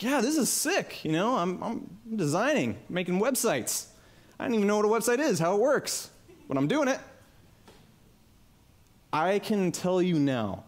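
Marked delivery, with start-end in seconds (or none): 0.0-7.0 excited. Retold or imitated. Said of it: "I was like".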